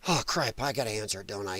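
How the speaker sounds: very low, hushed, sinking voice; mock resignation. Netanyahu realising he must take the call, low resigned mutter. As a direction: mutter it in reluctant defeat, acting. Acted reluctant giving in to call.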